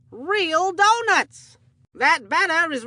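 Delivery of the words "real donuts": drawn out slowly, each word leaned on; slow it right down to sell it; slow emphatic. Acted. Announcer stresses the donuts are real, slow and deliberate.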